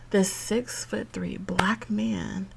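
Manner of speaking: slow, hushed whisper; hushed aside. Real, not acted. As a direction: drop to a whisper as if telling a secret. Lowered voice, slow.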